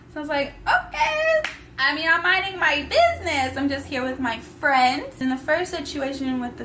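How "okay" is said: a squeaky, much higher "Okay." thrown off lightly; high mock okay. To herself, brushing off his pill: high mock okay. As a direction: fake breezy okay, pitched way up. Feigned agreement after he pops pill.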